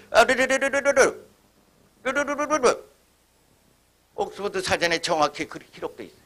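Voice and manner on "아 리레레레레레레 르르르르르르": high rolling trill, fading off; ox-driving call. Retold or imitated. Farmer calling the ox to turn, high rolling trill. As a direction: trill the tongue like a farmer turning his ox. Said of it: "소보고 돌라고 이리 돌어 그래".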